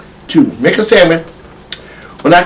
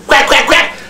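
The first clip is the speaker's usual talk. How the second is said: duck quacks; 11 semitones higher than usual. Acted. Duck puppet.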